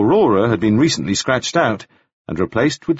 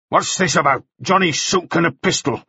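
Mannering, curt and questioning, pitched higher; a hint of a suspicious mine owner; lightly voiced Mannering; 7 semitones higher than usual. A narrator's reading. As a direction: boss interrogating a worker, lightly voiced.

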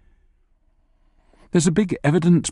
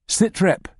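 raised call through a door; 7 semitones higher than usual. Acted. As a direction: call it out briskly. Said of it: "you call".

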